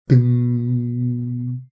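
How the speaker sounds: long, steady hummed "ding"; non-vibrating string ding. Shown as a demonstration. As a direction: imitate a string ringing on evenly. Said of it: "It's not vibrating".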